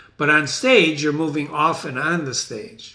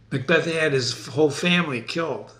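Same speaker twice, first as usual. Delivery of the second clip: usual speaking voice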